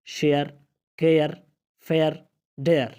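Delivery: شیئر، کیئر، فیئر، ڈیئر each with a two-part "e-a" vowel; A as "e-aa" glide. Shown as a demonstration. Teacher reading examples, شیئر، کیئر، فیئر، ڈیئر word by word with "e-a". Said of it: "اے کی آواز کبھی اے آ کی آتی ہے".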